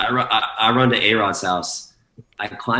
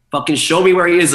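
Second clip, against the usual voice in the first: retold urgent demand, higher voice; panicked demand; 9 semitones higher, 6 dB louder than usual. DJ demanding to see his missing manager, panicked. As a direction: mimic a frantic insistence. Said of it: "looking for him in a panic".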